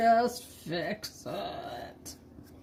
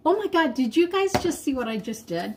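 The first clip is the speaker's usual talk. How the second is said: quick, higher, surprised exclaim; 6 semitones higher, faster than usual. Real, not acted. Notices her painting mistake.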